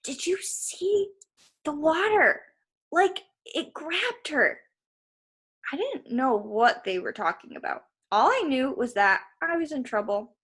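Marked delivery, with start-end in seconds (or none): 0.0-4.6 put-on higher, slow, awed kid voice; hushed amazement among classmates; kids whispering. A narrator's reading. Kids gossip about the water, high awed voices. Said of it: "Some of the kids were whispering".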